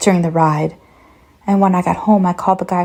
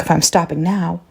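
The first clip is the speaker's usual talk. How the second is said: plain reading voice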